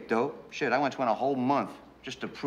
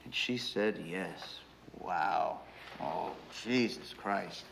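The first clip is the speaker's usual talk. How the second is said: slow, soft, lower disbelief; hushed awe; 3 semitones lower, 6 dB quieter, much slower than usual. Real, not acted. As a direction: let it sink in quietly, slowly. Recalls her accepting his proposal.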